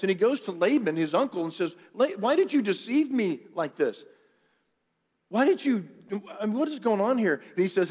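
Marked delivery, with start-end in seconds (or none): 1.9-7.4 indignant protest. Retold or imitated. Jacob confronts Laban over deception.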